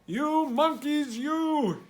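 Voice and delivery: high, slow scolding voice; scolding peddler. Acted. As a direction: wag a finger and scold the monkeys.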